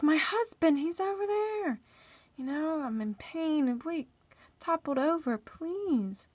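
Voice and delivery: high, urgent imitation of pleading; pleading wife. Wife begging a stranger for her husband, pleading. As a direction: beg for help, voice lifted.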